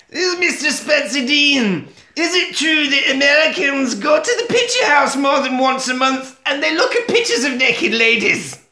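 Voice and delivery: high, put-on Scottish woman's accent; indignant mock-Scottish voice, pitched right up; Kate. Kate challenges the American visitor, shrieky Scottish accent. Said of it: "gets her back up and she says".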